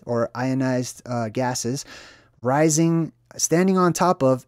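normal talking voice

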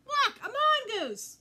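put-on high, slow, loud child's shout; boy shouting. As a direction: call out in alarm like a little boy. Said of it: "a boy shouted".

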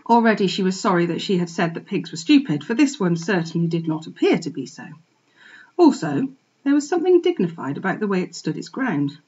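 usual speaking voice

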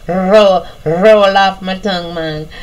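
light Jamaican accent; a touch of Jamaican patois. Shown as a demonstration. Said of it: "I'm gonna put on my little Jamaican accent".

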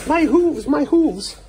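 very high, loud animal character; squeaky zebra voice. Pretend. Dad voices a zebra about its hooves, squeaky. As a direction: squeal it as a little cartoon zebra.

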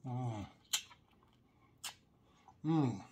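humming while chewing; closed-mouth eating sounds; mouth full, chewing. Real, not acted. Seen 1: Hand feeds asparagus into mouth, then closed-lip chewing throughout.

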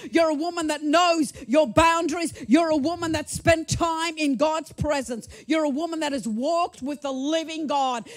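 ordinary talk

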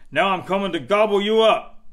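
loud, quick troll threat. A narrator's reading. Troll threatening to eat second goat, loud and quick. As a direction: bellow it fast and menacing. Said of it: "said the troll".

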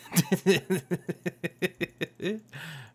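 high, stuttering run of laughter; long laugh. Real, not acted. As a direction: keep laughing at your own joke. Laughs after mock warning.

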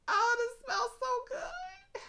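high, slow, near-tearful rapture; swooning delight. Real, not acted. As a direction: cry it out as if overcome by the scent. Swooning over the perfume.

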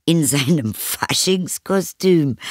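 high, slow, amused echo question; amused mother. A narrator's reading. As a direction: repeat the child's excuse in amused disbelief. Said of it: "lachte die Mutter". (belustigt staunende Mutter)

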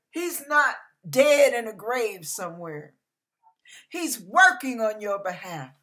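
usual speaking voice

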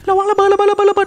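high, loud warning yell; alarmed shout. Real, not acted. Streamer yells a warning as a bomb goes off.

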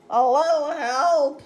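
loud cry for help; calling for help. Acted. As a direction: call out for a little help.

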